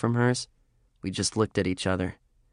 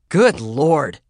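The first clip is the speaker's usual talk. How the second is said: shocked gasp; 5 semitones higher, 7 dB louder than usual. Acted. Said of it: "Lorraine gulped".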